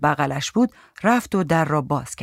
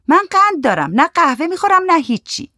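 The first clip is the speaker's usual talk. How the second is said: high, louder character voice; high woman's voice; 12 semitones higher, 6 dB louder than usual. A narrator's reading. Goli declining coffee and sweets, high-pitched woman. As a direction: refuse in a high, loud woman's voice.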